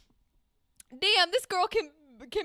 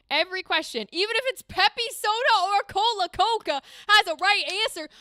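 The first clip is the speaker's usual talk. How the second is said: emphatic rant; 4 semitones higher, 6 dB louder, faster than usual. Real, not acted.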